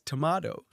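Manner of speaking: "Tomato" with British "ah" vowel, American soft d; hybrid American accent. Retold or imitated. Repeating how she says it, "Tomato" as "tuh-MAH-doh". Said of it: "She says".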